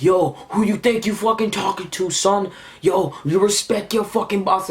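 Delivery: high-pitched aggressive caricature; mocking tough sidekicks. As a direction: mimic hangers-on talking tough. Acts out boss's friends ganging up.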